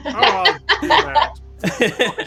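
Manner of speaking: laughing. Real, not acted. Player laughing at the rune.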